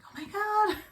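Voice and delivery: high, loud excited outburst; gushing re-enacted excitement. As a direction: relive the thrill, pitch leaping up. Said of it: "I was really excited about that".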